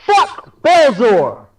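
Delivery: loud defiant shout. Acted. Captain shouts to the whole crowd, loud and slow. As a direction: yell it slow so everyone hears. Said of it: "really loud, so everybody hears".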